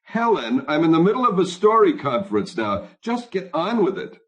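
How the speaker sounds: high, exasperated phone voice; impatient husband. A narrator's reading. Husband in a story conference hurries his wife, impatient and high. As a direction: cut in impatiently with pitch climbing.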